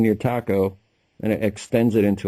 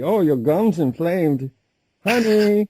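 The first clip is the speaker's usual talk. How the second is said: high, loud put-on Chinese accent in broken English; mock Chinese accent; 9 semitones higher, 4 dB louder, slower than usual. Friend imitating the wife selling a cure in a Chinese accent. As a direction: ham up a Chinese herbal seller's accent. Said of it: "That's a great accent".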